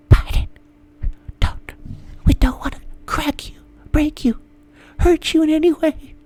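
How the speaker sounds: high cooing whisper; breathy mock tenderness. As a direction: whisper it like soothing a fragile baby. Media whispering to Biden.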